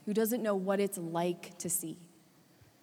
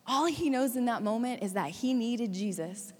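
ordinary talk, nothing marked

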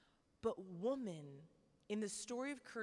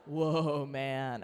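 awed whoa; 10 semitones lower, 11 dB louder than usual. Pretend. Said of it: "He's like".

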